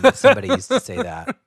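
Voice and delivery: high, fast, through laughter; laughing it off. Real, not acted.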